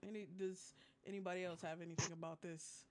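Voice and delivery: normal talking voice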